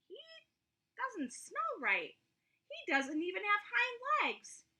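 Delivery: high, sneering exclamation; wild rabbit mocking the toy, high-pitched; exclaiming. A narrator's reading. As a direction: scoff at the odd stuffed rabbit. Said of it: "exclaimed the rabbit".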